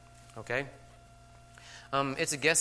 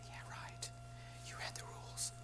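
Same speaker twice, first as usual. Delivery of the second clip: sarcastic whisper; 17 dB quieter than usual. Retold or imitated. Teacher's sarcastic inner reply.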